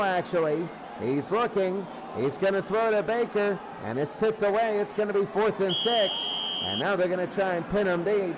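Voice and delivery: ordinary delivery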